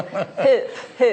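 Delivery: "Hoop, hoop" in her own Scottish way, laughing. Shown as a demonstration. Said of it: "Hoop is how we say it in Scotland".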